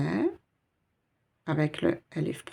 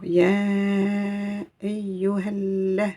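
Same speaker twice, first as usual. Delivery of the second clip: ayyuha la run together, drawn out; slow linked recitation; 2 semitones higher, much slower than usual. Shown as a demonstration. Teacher to students, chanting the linked words slowly. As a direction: glide ayyuha straight into la, slowly. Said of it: "je vais faire directement la liaison".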